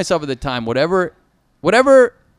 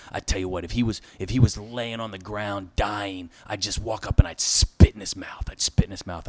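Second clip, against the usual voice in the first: menacing low threat; 7 semitones lower, 6 dB quieter than usual. Retold or imitated. Retelling Charlie Sheen's threat, low and soft. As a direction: lower and softer, a mild imitation of a quiet grudge. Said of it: "He goes".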